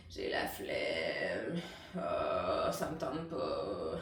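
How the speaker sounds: very low, very slow creak; bored teen dragging it out in fry; lazy vocal fry. Shown as a demonstration. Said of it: "donc je suis en fry". (ado blasée, fry grave et lent)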